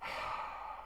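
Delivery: long, voiceless heavy exhale; Roman Reigns's exasperated sigh. Retold or imitated. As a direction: imitate a fuming, let-down sigh. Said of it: "Roman's furious with Jay".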